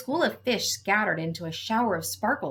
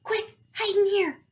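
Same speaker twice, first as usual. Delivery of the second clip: high, urgent hiding call; urgent alarm; 11 semitones higher, 3 dB louder than usual. A narrator's reading. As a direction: hurry them into cover, pitched up. Urging others to hide.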